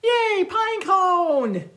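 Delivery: high, slow delighted shout; joyful cry. Acted. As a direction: cheer with pure delight. Penguin finds the grown tree.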